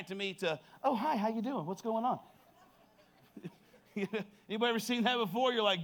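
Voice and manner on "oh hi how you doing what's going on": higher, sing-song mock phone greeting; put-on friendly hello; sweet phone voice. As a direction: imitate a wife suddenly all pleasant on the phone. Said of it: "all of a sudden she'll get a phone call".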